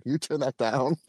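mock stern wife telling him to turn it down; a voiced-up scolding wife; husband mimics his wife snapping at the TV noise; imitating wife's scolding. Wife scolding in the retold story.

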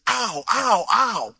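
high, repeated "ow" of pain; mock pained cries. Injured runner heard from behind, mock cries of pain.

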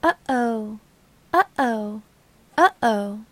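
little sing-out, falling in pitch; childlike phantom, each one set apart. Acted. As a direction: chirp it like a small child. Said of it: "there is a childlike voice that comes in through the deck".

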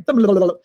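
babbled imitation of a fluttering heartbeat; tumbling heart-flutter sound effect; racing heart noise. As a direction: sputter out an irregular heartbeat. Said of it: "my heart starts going".

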